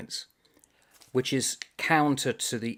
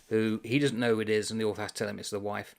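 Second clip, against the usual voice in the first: normal talking voice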